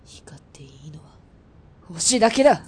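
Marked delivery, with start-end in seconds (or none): 1.8-2.6 breaks from whisper into a loud cry; triumphant shout. Acted. Sniper shouting as he takes the shot, triumphant shout. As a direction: snap out the line as he fires.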